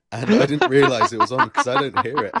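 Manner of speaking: talking through a high laugh. Real, not acted. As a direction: laugh it out, embarrassed. Laughing at her own blunder.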